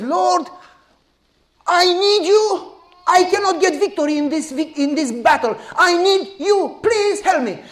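pleading prayer. Acted. Joshua pleads with the Lord in prayer, high and urgent.

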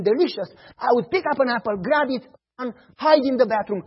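ordinary talk

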